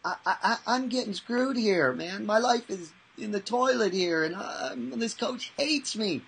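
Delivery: put-on high, complaining twelve-year-old; a hurt boy protesting to his mental coach; whiny kid. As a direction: pitch up into a wounded kid's whine. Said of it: "he goes".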